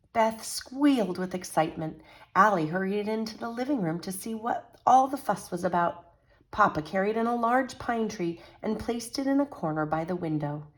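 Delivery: lively picture-book read-aloud; animated storytelling to children; story narration. A narrator's reading. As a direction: read it like a bedtime story for kids.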